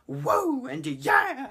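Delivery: a burst of manic joy from the dancing lady; wild excited whoop. Retold or imitated. Old lady starts dancing wildly.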